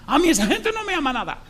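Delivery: grito furioso muy agudo; escúpelo con rabia de enfermo. Retold or imitated. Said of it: "Se puso rojo de la ira el tipo". (enfermo furioso contra su familia, a gritos)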